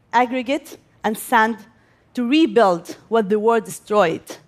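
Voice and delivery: normal talking voice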